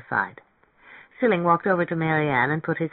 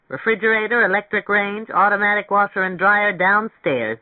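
higher, louder, affronted sales pitch; offended; 5 semitones higher, 4 dB louder than usual. A narrator's reading. Rental agent lists features to a customer who said no, offended. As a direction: push each feature with wounded pride.

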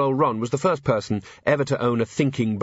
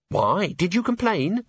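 curious question leaping much higher; lightly voiced questioner; 8 semitones higher than usual. Cheery asking Angua about the jokes, curious. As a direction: ask with a sharply rising lilt.

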